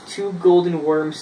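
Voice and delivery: ordinary delivery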